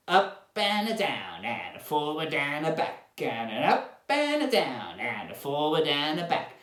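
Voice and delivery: drawling cowboy with swooping pitch. Shown as a demonstration. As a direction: stretch it out like a cowboy. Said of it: "cowboy voices".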